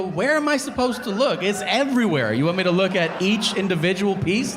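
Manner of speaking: high, defensive exasperation; exasperated. Retold or imitated. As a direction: protest like it's obvious.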